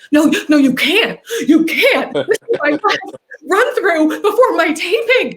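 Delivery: high, loud, laughing panic; half-laughing desperate protest; laughing plea. Retold or imitated. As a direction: beg through laughter, pitched high. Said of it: "I'm like".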